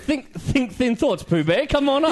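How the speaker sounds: slow, high sing-song coaxing a mate; mock coaxing. Teasing his mate stuck in the manhole, slow high coaxing. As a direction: coax him through like Pooh Bear.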